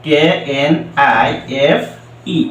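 normal talking voice

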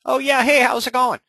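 cheery phone greeting. Retold or imitated. Agent picking up a homeowner's callback, overly cheery. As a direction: answer like you're thrilled they called.

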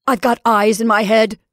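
loud, testy comeback; irritated retort. Acted. Caroline to her frightened sister, irritated retort. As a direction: put her in her place.

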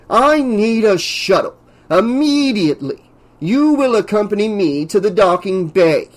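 high, imperious order; demanding. A narrator's reading. Professor blocks the door and orders the pilot, demanding. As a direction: demand it as if owed obedience. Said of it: "he demanded".

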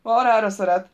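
a loud, indignant outburst; snapping. A narrator's reading. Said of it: "csattant fel Samantha". (csattanva, felháborodottan)

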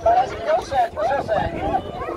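high, delighted exclaim; excited. Real, not acted. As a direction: squeal it with glee. Trick seems to work, delighted.

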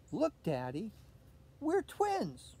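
put-on high, excited little girl; delighted girl. A narrator's reading. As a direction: squeal with delight at Daddy. Olivia delighted with Dad.

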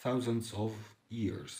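drawn out slowly and softly; slow it down for the class; gentle slow dictation. Shown as a demonstration.

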